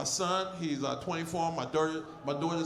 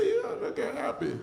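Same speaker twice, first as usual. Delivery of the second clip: goofy voice; 9 semitones higher than usual. Retold or imitated.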